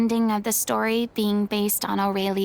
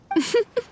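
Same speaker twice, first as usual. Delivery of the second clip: a quick burst of laughter; brief amused laugh; short laugh; 10 semitones higher than usual. Real, not acted. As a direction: laugh out suddenly, then stop. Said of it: "What are you laughing at?".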